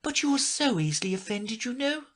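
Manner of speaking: put-on high girlish protest; pleading. A narrator's reading. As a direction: reason with them pleadingly. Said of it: "pleaded poor Alice".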